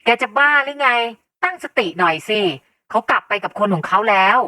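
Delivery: mother's sharp shout; loud scolding. A narrator's reading. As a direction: snap loudly at a son to come to his senses. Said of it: "แม่ตวาดเสียงดัง". (ตวาดเสียงดัง)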